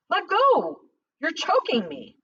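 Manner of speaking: high, strained plea of a man being choked; panicked cry. Acted. Jennings pinned to the wall, crying out in panic.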